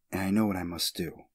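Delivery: usual speaking voice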